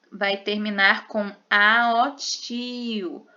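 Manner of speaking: usual speaking voice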